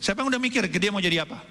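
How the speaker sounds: ordinary talk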